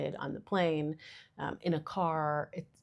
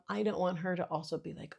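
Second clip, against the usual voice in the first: normal talking voice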